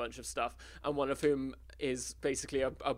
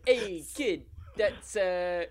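Stretch voice; 4 semitones higher, slower than usual. Acted. Another try at Stretch.

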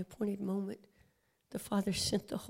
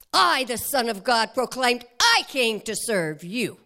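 thundering indignant rebuke, much louder; Holy Spirit rebuke; 11 dB louder than usual. Acted. Holy Spirit confronting legalism in the congregation, thundering. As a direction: roar the rebuke at religion. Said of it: "That was the Holy Spirit".

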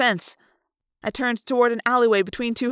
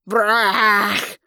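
elephant trumpet; 2 semitones lower, 4 dB louder than usual. Acted.

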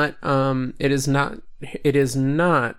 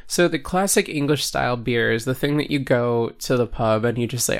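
ordinary talk, nothing marked

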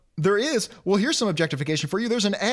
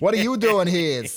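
put-on Boston accent; 4 dB louder than usual. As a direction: lean into the Boston vowels. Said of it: "[name] can't do a Boston accent".

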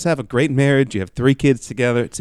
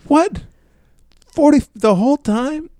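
shocked. Real, not acted. Shock at grandpa's dreams.

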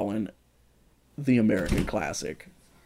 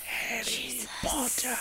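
high, slow unvoiced hiss; snake hiss; 12 semitones higher, 10 dB quieter, slower than usual. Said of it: "pop culture snake".

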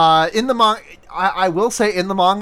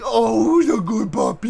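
gushing over puppy; 5 semitones higher than usual. Retold or imitated.

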